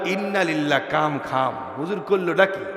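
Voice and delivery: low, hushed aside; dismayed aside. Retold or imitated. Jaber realises the trouble he is in, hushed dismay.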